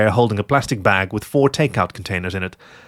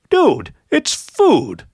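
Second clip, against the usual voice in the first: indignant; 12 semitones higher, 4 dB louder, much slower than usual. A narrator's reading. Fox protesting indignantly.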